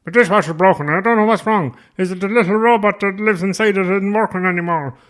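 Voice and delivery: high, loud, hammed-up pathetic pleading; helpless Irishman. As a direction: ham it up like a clueless caller begging for help.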